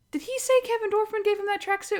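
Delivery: high, disbelieving question; can't believe what she heard; retelling her own shocked question; incredulous. Retold or imitated. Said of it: "and I was like".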